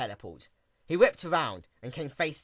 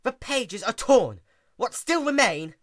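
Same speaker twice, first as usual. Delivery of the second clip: higher, louder, slow outrage; furious; 9 semitones higher, 7 dB louder than usual. A narrator's reading. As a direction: clamp down on rage and let it leak. Twilight furious at the stallion.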